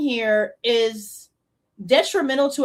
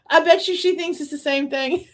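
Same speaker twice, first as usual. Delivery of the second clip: amused, higher-pitched voice breaking into laughter; laughing; 4 semitones higher than usual. Real, not acted. Commentator mocking her mix-up over the weapon, laughing. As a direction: say it through a laugh.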